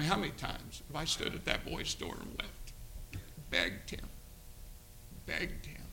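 softly, with long pauses; pained recollection. Real, not acted. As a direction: let the voice drop away in sorrow. Recalls weeping and begging at door.

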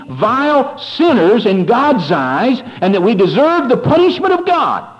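rising to a high, ringing preacher's cry, slow; preaching at full force. Real, not acted. Preacher to congregation, building to a high slow cry. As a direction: drive it home from the pulpit, climbing high.

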